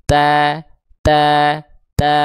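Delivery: held TA with a thin front A; thinned ta. Shown as a demonstration. Teacher drilling learners, ta repeated with thin A. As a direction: say ta thinly, mouth open with a little smile. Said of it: "Tipiskan pelafalannya".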